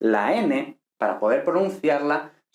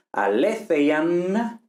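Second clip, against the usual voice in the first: aletheian drawn out syllable by syllable; teacher's slow model word; slow careful word; 4 semitones higher, much slower than usual. Shown as a demonstration. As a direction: spell out aletheian slowly. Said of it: "por ejemplo".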